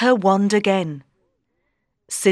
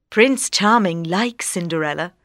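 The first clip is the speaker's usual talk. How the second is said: ordinary delivery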